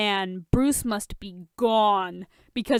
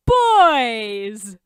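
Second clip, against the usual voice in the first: loud gleeful crow; 11 dB louder than usual. A narrator's reading. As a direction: announce yourself triumphantly. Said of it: "Lex Luthor crows".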